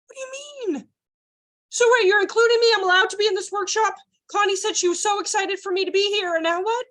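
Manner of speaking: high mock indignation; high hurt protest, hammed-up; indignant. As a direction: act the excluded adult. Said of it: "And you're thinking".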